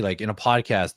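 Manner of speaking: usual speaking voice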